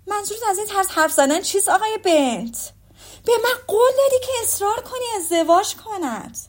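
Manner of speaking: high-pitched, affronted protest; indignant wife. A narrator's reading. Mrs. Bennet reproaches her husband over his promise, indignant.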